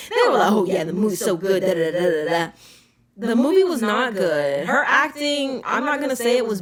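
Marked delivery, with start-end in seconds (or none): 0.5-2.5 rattled-off mock praise, quick and dismissive; mocking imitation. Movie reviewer parroting online fans' praise, dismissively mocking. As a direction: imitate gushing fans in a quick throwaway voice.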